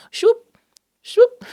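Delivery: high, loud whooshing shoop; squeaky sound effect of a swipe. Shown as a demonstration. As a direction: imitate an app swipe noise. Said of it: "that's the sound it makes".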